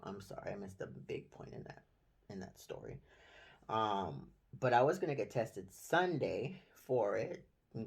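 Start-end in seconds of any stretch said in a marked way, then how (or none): 0.0-3.0 whispered aside. Real, not acted. Apologetic aside to the camera.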